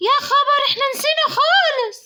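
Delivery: put-on high child's voice with a gasp and a laughing cry; a little girl panicking over forgotten homework; alarmed little girl. Little girl remembering the teacher asked for clay, high and alarmed.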